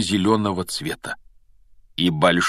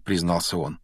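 ordinary delivery

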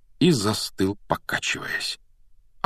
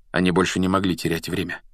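ordinary delivery

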